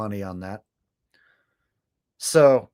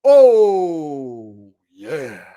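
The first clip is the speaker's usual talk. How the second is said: louder drawn-out hype shout; hammy wrestler "oh"; hammed-up wrestler cry; 8 dB louder than usual. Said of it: "The Rock doesn't say that".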